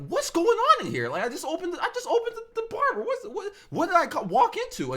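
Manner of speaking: high-pitched, stammering mock bewilderment; alarmed disbelief. Reacting to screams on opening the barber's door, high mock alarm. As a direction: squeal it out, tripping over the words. Acts out his shocked reaction.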